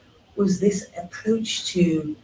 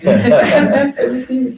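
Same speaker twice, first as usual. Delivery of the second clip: laughing; 9 semitones higher, 9 dB louder, much slower than usual. Real, not acted. Laughs handing over.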